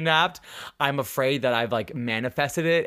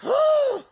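shocked gasp; 4 dB louder than usual. Real, not acted. Shocked at Munchausen.

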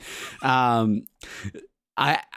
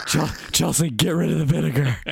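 mock doctor voice; 6 semitones higher than usual. S1 voicing the doctor.

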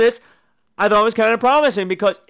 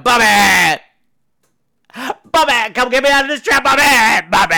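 hammed-up loud, higher pleading cries; 4 semitones higher, 5 dB louder than usual. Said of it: "You're like [name] right now".

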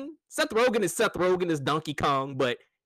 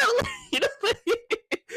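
high giggling through the words; cracking up mid-sentence; laughing. Real, not acted. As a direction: laugh it out breathlessly.

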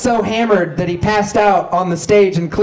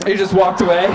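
ordinary delivery

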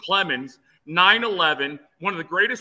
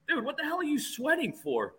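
high, incredulous question without extra volume; mock incredulous; 10 semitones higher, 5 dB quieter than usual. Pitcher to his sweating bullpen catcher, mock incredulous. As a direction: retell it as baffled teasing. Teasing a sweating teammate, retold.

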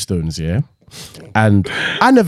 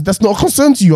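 dad incredulous; 8 semitones higher, faster than usual. Retold or imitated. Dad voiced in retold story.

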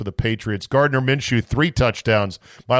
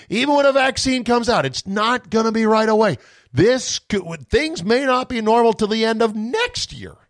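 higher, whiny mock-cautious voice lifted well above his own; mocking Fauci; 9 semitones higher than usual. Host mocking Fauci's Friday warning, in a higher, whiny mock voice. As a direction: mimic a fretting official pleading for more lockdown. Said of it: "was out there again on Friday going".

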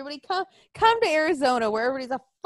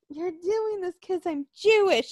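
higher-pitched whiny accusation; mock indignant protest; 5 semitones higher than usual. Quoting woman's protest to the cop, mock indignant protest. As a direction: mimic her protest in a raised pitch.

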